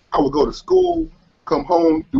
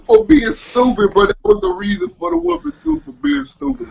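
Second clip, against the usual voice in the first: laughing; 8 semitones higher than usual. Real, not acted. Laughing through the punchline.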